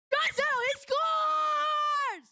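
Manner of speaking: huge high drawn-out goal scream; goal call shout. Real, not acted. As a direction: roar the goal like a broadcaster. Goal call in live stream.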